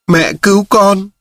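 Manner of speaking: put-on a frightened child on the verge of tears; whimpering child. A narrator's reading. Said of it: "Chú bé mếu máo".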